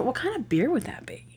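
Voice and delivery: soft unvoiced whisper; hushed musing whisper; whispered aside. Real, not acted. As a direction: whisper it to yourself as a side thought. Whispered aside about the beer.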